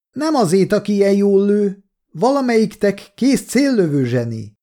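high-pitched shouted reply; calling out. A narrator's reading. Kolja praises the marksman outside, calling out. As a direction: call the compliment out to them.

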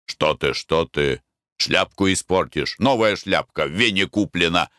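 plain reading voice

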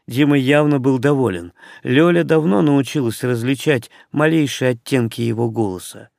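usual speaking voice

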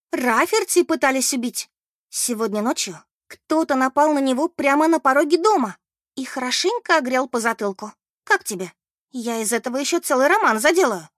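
high, excited bearer of shocking news; Beth, dramatic gossipy friend. Acted.

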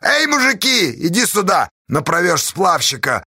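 high rallying call, easing down; straining yell. A narrator's reading. Pozdey summoning the men, straining yell. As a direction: strain to call the crowd over. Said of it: "надрывался Поздей".

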